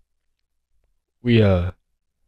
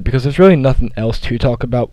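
normal talking voice

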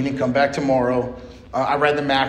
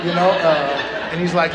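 ordinary talk, nothing marked

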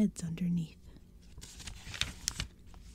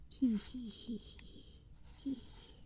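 evil dry cackle; 4 semitones higher, 7 dB quieter, much slower than usual. Acted. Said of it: "Another dry laugh".

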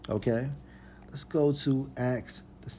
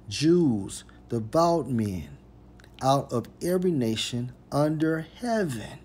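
raised preacher's reading, punching key words; emphatic scripture reading; 3 semitones higher, 5 dB louder than usual. Acted. Preacher reading Acts to listeners, raised, emphatic scripture reading. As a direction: proclaim the verse phrase by phrase.

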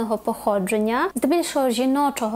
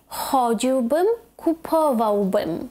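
CHOdziłbym, kuPOwałbym, stretched out; third-from-last stress; much slower than usual. Shown as a demonstration. Teacher modelling the conditional, stress third from end. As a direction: lean on CHO and PO in "Chodziłbym, kupowałbym". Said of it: "теж на третій склад з кінця".

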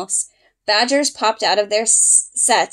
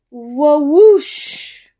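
louder, higher Wah-whoosh with wind in it; bigger whoosh; 4 semitones higher, 5 dB louder than usual. A narrator's reading. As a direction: swoop the word up like wings beating. Eagle wingbeat sound, louder.

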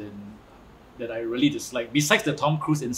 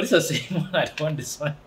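ordinary talk

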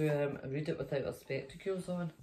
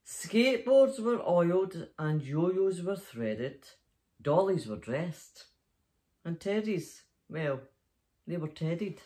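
slow, lilting bedtime rhyme reading; cosy Christmas storybook narration; story reading for children; slower than usual. Acted. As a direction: read it like a bedtime story to little ones. Reads the story aloud.

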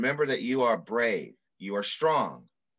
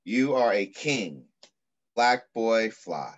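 put-on reading to children. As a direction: read it like an affirming poem to children. Reads picture book aloud.

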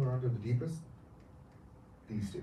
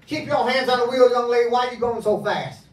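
Southern cop; 10 semitones higher, 8 dB louder than usual. Acted. Hypnotist playing the Southern cop, loud Southern accent. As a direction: bark it like a Southern sheriff. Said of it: "he speaks in a southern accent".

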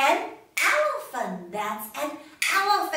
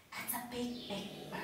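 whisper; 3 semitones lower, 13 dB quieter, faster than usual. Shown as a demonstration. Whispered ending.

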